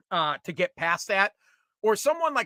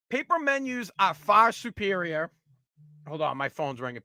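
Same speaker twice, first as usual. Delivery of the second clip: ordinary delivery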